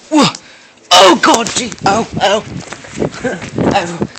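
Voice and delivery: high, loud panicked yells; scared scream. Real, not acted. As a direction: shriek in real fright. Jump scare.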